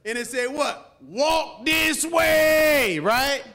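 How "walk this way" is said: loud, slow, high shout of the title; shouted song line. Acted. Preacher shouting the old song title at the congregation, slow and loud. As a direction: belt the song hook to the crowd.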